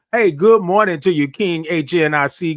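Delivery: ordinary talk, nothing marked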